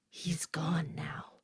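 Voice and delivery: cold breathy whisper, slow. Acted. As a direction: hiss it out icily. Killer's cold threat.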